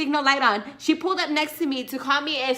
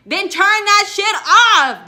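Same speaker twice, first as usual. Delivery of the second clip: fed-up demand; 8 semitones higher, 11 dB louder, slower than usual. Real, not acted. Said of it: "I can't stand when".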